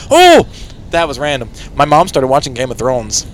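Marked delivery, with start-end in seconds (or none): 0.1-0.5 high, loud "oh"; short loud cry; sudden playful whoop. Real, not acted. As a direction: burst out with a random hoot. Mock hurt, random outburst.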